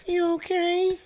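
high, sing-song fake concern; mock caring wife voice. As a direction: coo it like a fussy wife.

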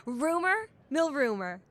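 bright, hyped entertainment host; gushing red carpet chatter. Acted. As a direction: sell the red carpet excitement.

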